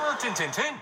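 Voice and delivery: comic Yorkshire demo; Yorkshire accent. Shown as a demonstration. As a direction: repeat "tin, tin, tin" as broad Yorkshire. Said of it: "My favourite Yorkshire phrase is".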